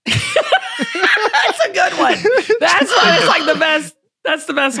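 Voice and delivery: high laughing through the words; laughing at the question. Real, not acted. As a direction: crack up while talking.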